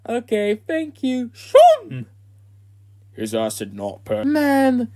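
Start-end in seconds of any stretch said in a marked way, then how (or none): 1.3-2.1 high cry. Acted. Sudden dramatic cry.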